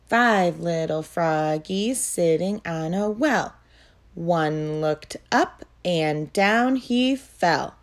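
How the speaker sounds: rhythmic sing-song poem reading; poem recitation. Acted. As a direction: recite it evenly for little kids. Teacher models two phrases.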